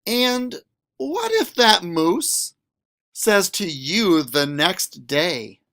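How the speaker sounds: lively, sing-song picture book read; reading to children. A narrator's reading. Teacher reading a picture book to students, animated. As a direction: read it to a class with big expression.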